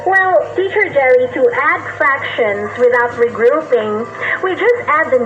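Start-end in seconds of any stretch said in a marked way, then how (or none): none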